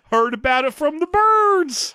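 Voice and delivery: slow, high cartoon prospector drawl; old prospector. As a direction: ham up a backwoods old miner. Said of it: "he talks like an old prospector".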